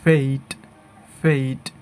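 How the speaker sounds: ফেইট with a long ei glide; correct pronunciation. Shown as a demonstration. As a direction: say ফেইট as "feit". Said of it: "সাধারণত সঠিক উচ্চারণ করি".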